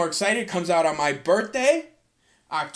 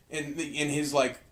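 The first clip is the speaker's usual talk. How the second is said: ordinary talk, nothing marked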